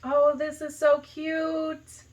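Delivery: ordinary talk, nothing marked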